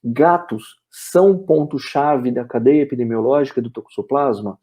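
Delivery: normal talking voice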